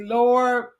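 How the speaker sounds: normal talking voice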